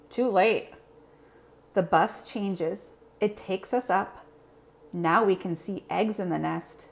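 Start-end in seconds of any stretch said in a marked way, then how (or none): none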